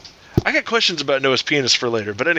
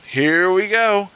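HERE stretched long, higher pitched; drawn-out repeat; 4 semitones higher, much slower than usual. Acted. Joke's customer gets ready at the bar, drawn-out repeat. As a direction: draw out "Here we go" slowly.

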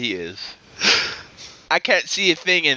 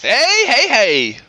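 loud call; 10 semitones higher, 6 dB louder, much slower than usual. Real, not acted. Interrupting to call attention.

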